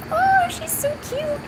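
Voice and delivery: very high, slow, soft coo; slow high cooing over the cat; gushing coo. Acted.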